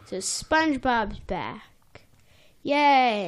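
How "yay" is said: ordinary talk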